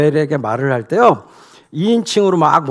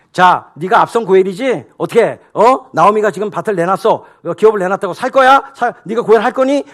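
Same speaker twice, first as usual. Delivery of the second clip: louder, higher jabbing questions; badgering; 5 semitones higher, 5 dB louder than usual. Boaz badgering the redeemer, loud and jabbing. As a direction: keep jabbing the same word. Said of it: "이렇게 막 몰아붙였어요".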